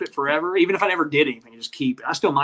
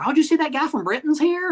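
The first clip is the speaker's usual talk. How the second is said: high-pitched buzzing townsfolk; pitched way up, playfully agog; mock excited gossip; 12 semitones higher than usual. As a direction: imitate a local spreading exciting news. Voicing excited locals spotting the Brit.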